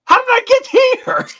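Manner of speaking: very high, loud mock-baffled cry; comically high-pitched disbelief; mock bewilderment. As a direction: squeal it like a baffled listener. Retold bewildered listener's outcry.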